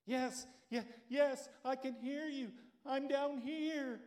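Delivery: clinging on and calling upward in relief; stranded climber answering the voice above him, frantic; frantic Jack. Acted.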